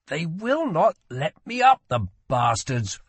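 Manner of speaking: high-pitched, indignant grumble; complaining about being kept in bed; grumbling prince. A narrator's reading. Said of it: "grumbled Rye".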